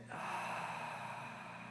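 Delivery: long, soft breathy sigh; demonstrated exhale. Shown as a demonstration. Meditation teacher breathing out with the group, long soft sigh. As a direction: let the breath out slowly through the mouth. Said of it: "and out".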